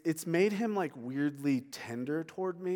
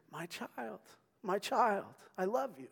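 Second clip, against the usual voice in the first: tender whisper; 6 semitones higher than usual. Pretend. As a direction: whisper it lovingly like a father. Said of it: "cosmically whispering".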